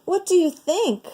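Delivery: high playful question to the cats; asking the cats. Acted. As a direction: lift it up, teasing the cats. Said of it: "she said".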